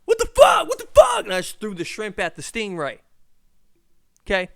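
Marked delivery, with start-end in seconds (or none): 0.1-1.2 shrieking high and very loud in fright; startled shrieks, far louder and higher; panicked scream. Retold or imitated. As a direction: yelp and scream like something just bit you. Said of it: "I go".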